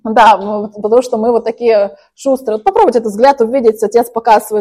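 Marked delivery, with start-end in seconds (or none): none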